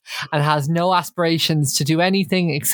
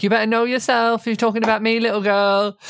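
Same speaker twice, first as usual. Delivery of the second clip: mildly raised imitation of a roar; a lightly raised hint of a yell; quoting Aisling's roar; 6 semitones higher, faster than usual. Podcaster quoting the housemate's line to friends, lightly raised. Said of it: "they have the iconic".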